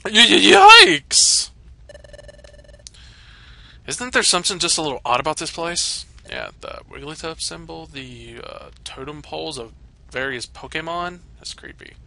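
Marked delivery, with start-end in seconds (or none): 0.1-1.5 high, loud stuttering yelp; scared stammer. Acted. Hero reaching the creepy guild, high frightened stammering yelp. 11.4-11.9 soft whisper of unease. Real, not acted. Gamer eyeing creepy totem poles, softly whispered.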